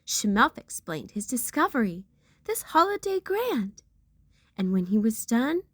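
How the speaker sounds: usual speaking voice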